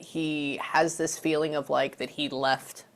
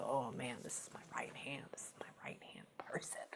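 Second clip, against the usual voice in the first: very soft, imitated whisper; whispered quote; 4 semitones lower, 19 dB quieter than usual. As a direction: voice the spirit's words under the breath. Voicing what the spirit says.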